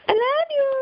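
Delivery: high, loud doting sign-off; high loud gleeful I love you; squealed goodbye. Real, not acted. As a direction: squeal your love to the dogs. Signing off to viewers.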